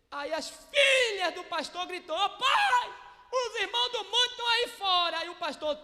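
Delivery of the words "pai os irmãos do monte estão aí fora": calling out. Retold or imitated. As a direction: shout it across the house to Dad. Daughters shouting to father.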